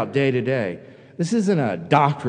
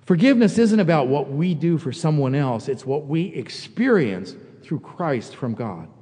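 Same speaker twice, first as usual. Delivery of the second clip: ordinary talk, nothing marked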